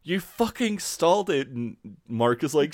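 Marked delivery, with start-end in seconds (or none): 0.0-1.5 mock shouting. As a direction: imitate an exasperated passenger shouting. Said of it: "just shouting at Mark".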